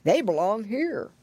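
put-on old pig grandfather; slurred elderly character voice; Grandpa Squealer. A narrator's reading. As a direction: say it as the oldest pig of all. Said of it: "he said".

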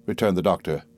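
ordinary delivery

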